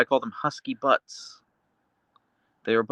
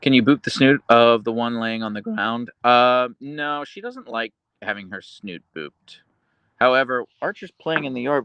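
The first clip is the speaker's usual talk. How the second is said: ordinary talk, nothing marked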